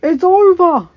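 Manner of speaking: high, very loud retort; full-force despairing shout; shouting back. Acted. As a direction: yell back that all is lost. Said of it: "Boggs shouted back".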